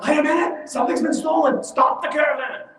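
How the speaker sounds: high-pitched acted alarm; urgent call-out. Someone jumps out to stop the caravan, urgently calling.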